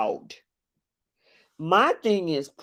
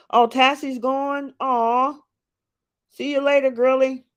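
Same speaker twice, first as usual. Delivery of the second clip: high farewell; 8 semitones higher, 3 dB louder than usual. Real, not acted.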